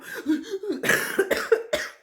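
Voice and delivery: high, loud, breathy imitated jolt; startled gasp. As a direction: mimic someone jumping out of their skin. The couple jumps in fright.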